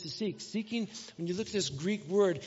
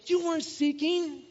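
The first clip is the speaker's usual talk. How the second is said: high, slow teasing jab; playful high jab; 9 semitones higher, slower than usual. Real, not acted. Preacher teasing the kid who didn't look, high and slow. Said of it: "preacher scolding playfully".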